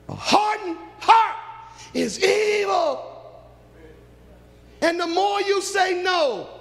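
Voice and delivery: preacher's roar; fiery preaching shout. Acted. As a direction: thunder it at the congregation. Preaching at full force.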